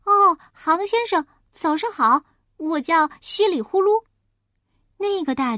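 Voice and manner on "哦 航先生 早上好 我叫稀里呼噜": high, loud, bright little pig greeting; a friendly piglet saying good morning; cheerful piglet. Acted. Piglet cheerfully greets the wolf, high and loud.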